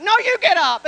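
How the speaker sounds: high, loud playful retort; wife answering her husband in a playful spat, loud; mock bickering back. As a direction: fire it right back, teasing. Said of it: "And then I'm like".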